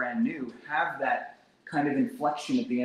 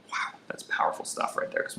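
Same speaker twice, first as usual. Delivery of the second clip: a hushed aside, barely voiced; whisper; 7 dB quieter than usual. Real, not acted. Quiet aside to the class on a powerful question. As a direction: breathe it out in awe. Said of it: "whispered aside".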